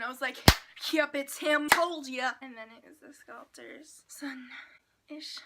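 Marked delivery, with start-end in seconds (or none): 0.9-2.3 smug told-you-so. Retold or imitated. Quoting her smug self. 2.4-5.4 whisper. Real, not acted. Whispered aside.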